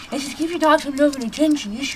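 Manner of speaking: ordinary talk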